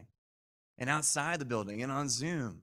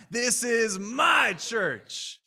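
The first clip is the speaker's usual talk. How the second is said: "my" drawn right out, high and loud; very slow, loud declaration landing on "my"; stress on MY; 7 semitones higher, 7 dB louder, much slower than usual. Shown as a demonstration. Preacher leads congregation in saying it, heavy stress on "my". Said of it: "say with emphasis on my".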